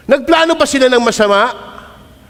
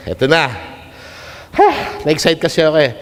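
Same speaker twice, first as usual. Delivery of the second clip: normal talking voice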